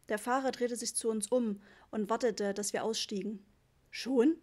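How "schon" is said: startled one-word question; mildly stunned dad; taken aback. A narrator's reading. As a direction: ask it baffled, a little louder. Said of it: "sagte Papa verblüfft".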